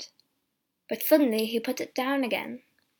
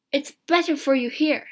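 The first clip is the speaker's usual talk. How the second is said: louder, higher, hasty answer; quick reply; 4 semitones higher, 7 dB louder than usual. A narrator's reading. Soldier discouraging the trip to India, quick reply. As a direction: cut in with a quick warning. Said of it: "the soldier said quickly".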